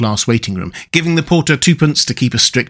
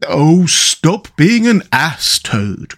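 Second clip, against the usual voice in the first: slow, pleading Mole, exasperated; despairing cry; 5 semitones higher, much slower than usual. A narrator's reading. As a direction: beg him to stop, at the end of his tether. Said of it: "cried the Mole despairingly".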